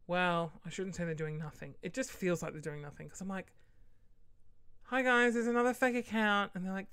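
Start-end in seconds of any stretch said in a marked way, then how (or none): 4.8-6.5 retold complaint. Retold report to Facebook.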